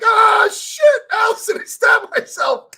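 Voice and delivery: high, loud cry of pain and panic; panicked yell. Retold or imitated. To Allison after stabbing his own leg, panicked crying yell. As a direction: yell out in shock at a fresh stab wound. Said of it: "And now I'm like".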